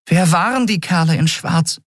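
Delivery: high-pitched little creature voice; Nelen, questioning. Acted. (hohe, fragende Figurenstimme)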